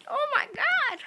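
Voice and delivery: high breathy mock gasp; replaying his own shock, squeaky. Kid recalls thinking the card was gold, squeaky gasp. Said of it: "I'm like".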